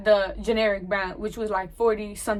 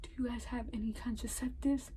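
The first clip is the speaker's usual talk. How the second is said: much softer, still half-whispered; hushed repeat; 14 dB quieter than usual. Retold or imitated. Repeats question to confused clerk.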